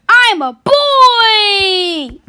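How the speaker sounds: high, slow wailing insistence; loud whiny crying protest. Acted. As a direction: cry it out, stretched. Argument over being a girl.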